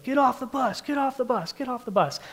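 high-pitched imitation yell, held back in volume; rapid-fire repeated order in a pretend scream; mock screaming sergeant. Drill sergeant ordering recruits off the bus, mock screaming. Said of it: "he starts screaming at us".

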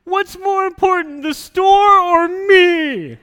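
put-on high, loud wailing child, stretched out; imitating a crying child. Dad acting out his daughter's tearful demand, loud high crying voice. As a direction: wail it like a heartbroken little girl. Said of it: "she looks at me and she goes".

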